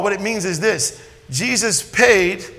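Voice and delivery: ordinary delivery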